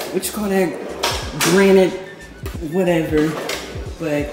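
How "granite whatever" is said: usual speaking voice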